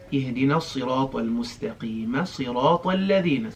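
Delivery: اهدنا الصراط المستقيم صراط الذين said quick, no long vowels; quick, unstretched. Shown as a demonstration. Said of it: "you don't stretch that too much".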